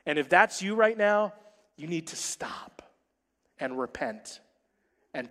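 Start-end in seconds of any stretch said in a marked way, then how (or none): 1.8-5.3 whispered plea to stop; hushed urging. Real, not acted. Preacher whispers to straying wives to stop. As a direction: lean in and whisper it.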